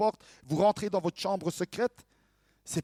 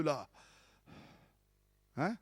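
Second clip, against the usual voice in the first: ordinary delivery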